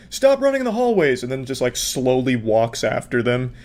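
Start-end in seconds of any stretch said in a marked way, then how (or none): 0.1-1.2 high-pitched scolding hall monitor; mock hall monitor. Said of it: "just like a hall monitor".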